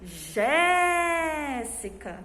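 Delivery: "Jéssica" with a very long, held Jé; teacher's demo call, "Jéssica" stressed on a sustained Jé; stressed syllable drawn out. Shown as a demonstration. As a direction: call "Jéssica" slowly, stretching the first syllable. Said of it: "Qual foi a sílaba mais forte?".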